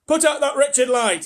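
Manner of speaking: high, loud, slow angry shout. A narrator's reading. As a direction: yell it at the ceiling. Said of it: "he shouted".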